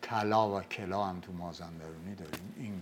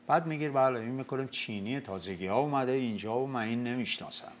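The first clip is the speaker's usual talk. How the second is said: ordinary delivery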